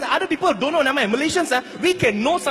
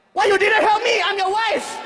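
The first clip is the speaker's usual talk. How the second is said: higher, louder indignant complaint; annoyed wife's reproach; upset wife; 8 semitones higher, 3 dB louder than usual. Retold or imitated. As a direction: scold your husband. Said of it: "my wife was like".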